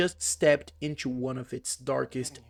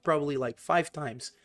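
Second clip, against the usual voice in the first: normal talking voice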